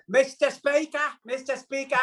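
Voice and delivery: a hint of a Commons heckle in English accent; British parliament. Guest shows off his English accent with a mild British "Mister Speaker" call. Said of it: "I can do".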